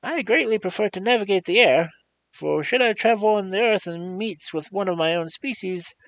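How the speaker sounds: very high, loud squeaky voice of a huge beast; squeaky creature voice. Acted. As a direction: squeak like a tiny thing in a giant body. The Gump answers.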